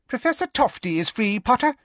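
very high little professor voice; squeaky. A narrator's reading. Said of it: "squeaked Professor Flitwick".

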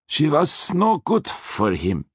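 put-on menacing Austrian accent, slow; heavy Austrian accent, threatening. A narrator's reading. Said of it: "in his menacing Austrian accent".

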